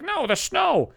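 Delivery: lightly voiced woman correcting. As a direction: correct him sharply. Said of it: "She's like".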